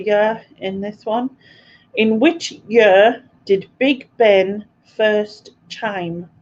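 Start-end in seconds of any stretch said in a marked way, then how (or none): 2.0-6.3 slow, word groups set apart by pauses; deliberate question reading. Real, not acted. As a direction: read it out slowly for quiz players. Quizmaster reads out the question.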